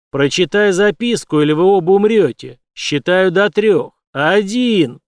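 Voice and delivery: loud, slow threatening count; menacing countdown. A narrator's reading. Villain threatening to kill them both, loud slow countdown. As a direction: count down like an executioner.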